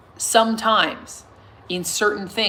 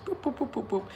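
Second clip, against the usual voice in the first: footstep noises; 5 semitones higher, 8 dB quieter than usual. Retold or imitated. Imitating footsteps down stairs.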